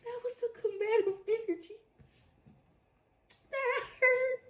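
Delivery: high, soft, squeezed voice; tiny high voice, laughed out of breath; weak from laughing. Real, not acted. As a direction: barely get it out after a laughing fit. Recovering from laughing fit.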